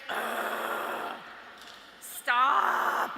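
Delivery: loud, fed-up groan then stop; annoyed ugh stop. Retold or imitated. Girl fed up with a friend's test worries, loud groan. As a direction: brush off a friend's test panic, loudly. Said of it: "And you're just like".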